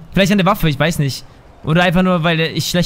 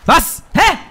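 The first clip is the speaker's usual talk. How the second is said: sudden high-pitched "Was?"; startled what; 12 semitones higher than usual. Real, not acted. Gamer startled mid-match, high disbelieving what. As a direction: yelp it in disbelief.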